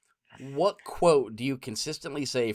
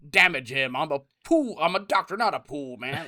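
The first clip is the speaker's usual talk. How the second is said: movie quote; 5 dB louder than usual. Retold or imitated. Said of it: "Oh, you know".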